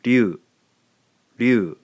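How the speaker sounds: "ryu" with a tapped r, loud and clear; Japanese ryu. Shown as a demonstration. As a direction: model "ryu" as a single syllable. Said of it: "gives us".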